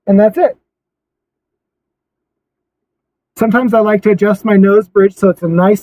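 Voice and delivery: muffled by cloth mask; speaking behind fabric covering; through a face mask. Real, not acted. Seen 2: Camo mask covers his mouth and nose throughout while he adjusts straps.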